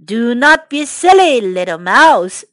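big lion voice dropping low; dismissive lion. Acted. Lion tells the mouse not to be silly, dismissive. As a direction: brush off the little one, sinking in pitch.

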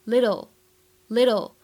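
"little" with tt as a quick d; American flapped t. Shown as a demonstration. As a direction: say "little" like "liddle". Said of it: "可以做一个美音浊化". (“little”美音浊化，t读成轻快的d)

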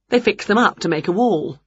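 usual speaking voice

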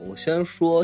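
usual speaking voice

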